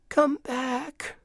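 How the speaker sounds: high, small plea; pleading little girl. A narrator's reading. Girl begging the vanished goose, pleading high. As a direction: beg in a high child's voice.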